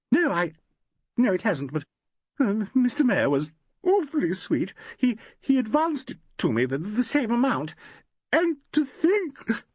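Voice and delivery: a distraught actress explaining herself; tearful young woman. A narrator's reading. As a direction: gush out the worry near tears. Valerie tearful about money.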